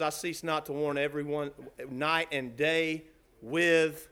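low, soft, slow recitation; grave and subdued, very low; hushed solemn scripture. Acted. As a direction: let the verse sink down quietly to its last word. Solemn scripture quote after loud preaching.

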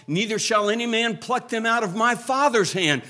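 full-throated revival preaching; preaching at full force. Acted. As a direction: proclaim it to the rafters, drawing out the last words. Preacher climaxes the scripture quote.

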